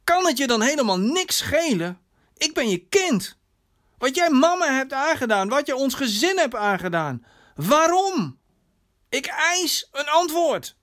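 hoge, woedende dochter die antwoord eist; schreeuwende dochter. Acted. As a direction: roep je vader hoog en fel ter verantwoording. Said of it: "schreeuwde zijn dochter". (woedend, verontwaardigd geroepen)

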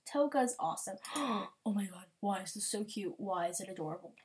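normal talking voice

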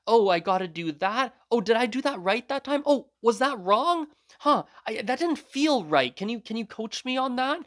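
high-pitched string of pleading questions; pitched well up, wanting feedback; imitated eager questioning. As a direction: re-enact a keen learner asking the coach. Acts out asking instructor eager questions.

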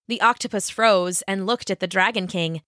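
ordinary delivery

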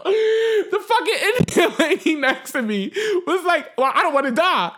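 high, slowed words through laughter; laughing retelling. Real, not acted. Storyteller quoting the Indian lady next to her, laughing. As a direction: crack up while quoting someone.

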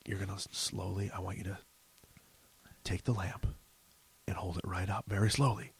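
low, near-whispered instructions; really quiet. Retold or imitated. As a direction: imitate him, hushed and careful. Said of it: "like, really quietly is like".

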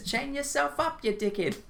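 put-on high, slow Kiwi accent telling a mate off; New Zealand accent, mock scolding; New Zealand werewolf accent. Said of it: "when he says".

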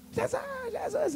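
very high cry, held back in volume; mock screaming. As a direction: imitate a woman screaming for Jesus. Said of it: "Começou a gritar lá". (imitando gritos agudos)

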